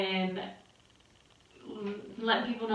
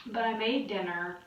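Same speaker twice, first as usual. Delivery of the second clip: normal talking voice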